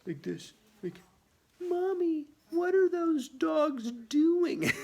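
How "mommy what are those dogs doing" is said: put-on high, loud slow kid question; a little kid loudly asking mommy; child voice. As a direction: ask wide-eyed like a small child. Voicing little kid again.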